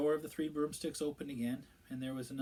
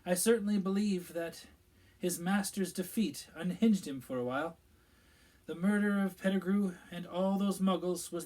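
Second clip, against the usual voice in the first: a higher, louder official's voice; Minister Fudge; 5 semitones higher, 6 dB louder than usual. A narrator's reading. Minister explaining events to the pub gathering, self-important. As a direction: voice the pompous minister telling the tale.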